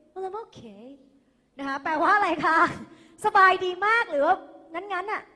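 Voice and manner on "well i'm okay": soft, half-hearted so-so answer; งั้นๆ, so-so. Acted. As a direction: answer as if just getting by, softly. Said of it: "อันนี้ก็จะออกแนวแบบว่ามัน นะ งั้นๆน่ะ". (ตอบแบบงั้นๆ เสียงเบา พอทน)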